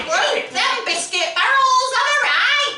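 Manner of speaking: high, slow broad Bolton voice; chummy Bolton lad, drawn out; Bolton accent. Acted. R2-D2 as a Bolton lad, Bolton accent. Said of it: "he's from Bolton".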